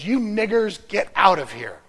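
loud, harsh yell at the swimmers; retold angry yell. Hotel manager yelling at ministers in the pool, loud angry yell. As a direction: bark it out like the furious hotel manager. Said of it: "And yells".

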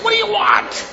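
high, loud shout on being woken; acted high-pitched yell; shouted question. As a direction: shout it out high, as if startled awake. Boy shouts reply when called.

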